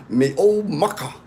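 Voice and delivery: lightly imitated British accent. Quoting a British friend's nickname for him, light British accent. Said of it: "he talks like that".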